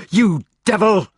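loud, high accusation; outraged cry. A narrator's reading. Gareth denounces his brother drawing a sword, crying out. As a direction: cry out in horror and anger. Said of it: "cried Gareth".

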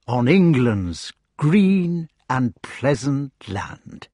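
slow, loud, grand declamation; solemn recitation. Acted. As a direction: proclaim it like a hymn. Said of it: "in a good pronunciation... meant it".

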